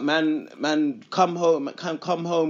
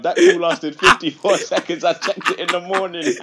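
laughing; 6 semitones higher, 8 dB louder than usual. Real, not acted. Laughing retelling of long call.

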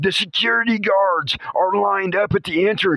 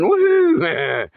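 excited cheer; 5 semitones higher, 3 dB louder than usual. Pretend. Finds utility apron.

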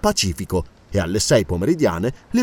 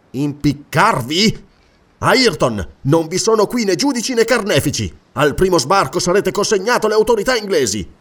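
firm, higher-pitched rebuke; indignant interrogator; 5 semitones higher, 3 dB louder than usual. Acted. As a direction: lay down the sentence with authority. (replica ferma e autorevole)

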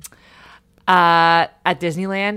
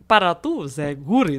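Spanish accent; 3 semitones lower than usual. Shown as a demonstration. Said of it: "is basically what I'm saying".

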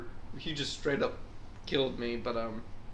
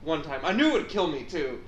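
ordinary talk

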